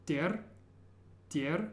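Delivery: "der" said by itself as a separate piece; last syllable alone. Shown as a demonstration. Teacher breaking a long word down for learners, last syllable alone. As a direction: isolate "der", the end of charakter. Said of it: "start by pronouncing the last syllable".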